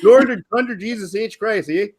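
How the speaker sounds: hammed-up Canadian accent, pitched high. Shown as a demonstration. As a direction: exclaim it like a caricature Canadian. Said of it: "You wanna know what a Canadian accent sounds like?".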